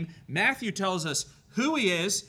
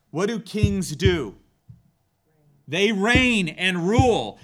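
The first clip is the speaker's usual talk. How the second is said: emphatic preaching; 7 dB louder than usual. Real, not acted. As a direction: drive the answer home to the congregation. Preacher builds to a climax.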